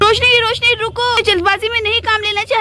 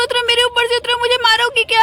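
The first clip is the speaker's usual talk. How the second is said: ordinary talk, nothing marked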